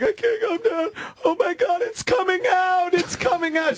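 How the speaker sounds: very high-pitched frantic imitation; mock panic. Woman driving with an anxiety attack, husband calming her, mock panic. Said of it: "trying to calm her down".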